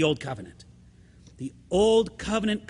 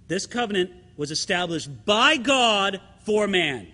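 normal talking voice

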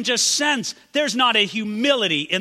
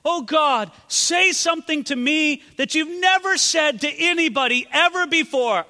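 higher, louder whiny demand for something new; mock pleading prayer; 9 semitones higher, 5 dB louder than usual. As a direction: ham up a greedy prayer. Mocking novelty-seeking prayer.

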